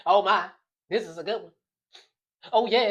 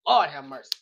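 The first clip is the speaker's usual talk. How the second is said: normal talking voice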